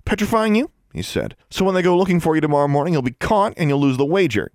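put-on high, fast smug boy; gloating boy. A narrator's reading. Larry gloats over Alexandra, smug. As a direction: rattle it off smugly.

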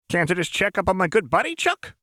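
high, fast, teasing chirp; Jinx, manic. A narrator's reading. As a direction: race through it, pitch soaring on the name. Jinx teases her buddy Chuck.

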